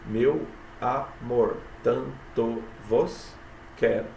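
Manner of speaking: "meu amor, tanto vos quero" very slow, each syllable separate. Shown as a demonstration. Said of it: "sete sílabas". ("meu amor, tanto vos quero" escandido, sílaba por sílaba, bem devagar)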